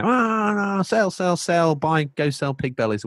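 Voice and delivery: high frantic trader shouting orders; mock frantic Wall Street trader; stockbroker yelling. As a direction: ham up a Wall Street trader barking sell. Mock stockbroker yelling on trading floor.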